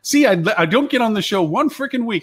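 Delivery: high-pitched, exasperated viewer griping at the hosts; mock incredulous outrage. Mocks incredulous viewer reacting to them.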